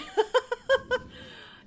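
high, loud giggling burst; startled laugh. Real, not acted. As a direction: laugh off the fright. Laughs after the scare.

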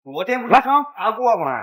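high, loud startled protest; shocked protest. Acted. 员工对又被罚款惊叫抗议，声音高而响. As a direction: yelp it in disbelief. Employee protests being fined again.

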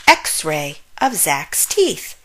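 ordinary delivery

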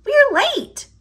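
high, squeaky mouse voice urging hurry; Squeak voice. Acted. Squeak to Pip, fretting they're late, in a high squeaky voice.

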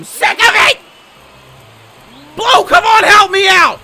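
shout at the umpire. Real, not acted. Gamer yelling at the umpire for a call, loud pleading shout. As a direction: call out begging for help.

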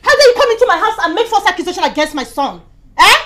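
loud, indignant tirade; how dare she, full force; mother raging at the girl for the accusation; angry confrontation. Acted.

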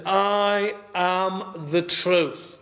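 each word drawn out slowly; slow solemn quote. Acted. Pastor voicing Jesus to the congregation, slow solemn emphasis. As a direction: let I am the truth land slowly, weighty. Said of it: "He says".

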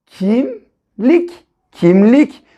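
Kimlik joined as kim plus lik in one flow; blended word kimlik. Shown as a demonstration. Teacher blending syllables into a word for children. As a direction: run kim straight into lik. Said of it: "Birleştirelim".

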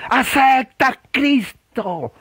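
high, slow, drawn-out plea; impassioned altar-call wail; emotional preacher. Shown as a demonstration. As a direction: imitate a fervent revivalist stretching every word. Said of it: "muy emotivas".

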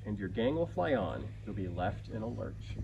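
ordinary delivery, nothing marked

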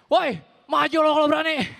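half-yelled dare, laughing at the edges; mild mock shout; mock brawl challenge. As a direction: call him out, lightly hammed. Same challenge, repeated.

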